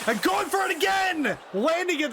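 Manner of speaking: sudden excited shout; excited call. Real, not acted. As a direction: call the move with a burst. Commentator calls a big move.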